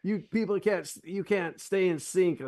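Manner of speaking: high, hammed-up angry director; retelling a movie director yelling at the singers; mock yelling director. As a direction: bark at the choir like a fed-up director. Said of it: "the director's yelling at him, like".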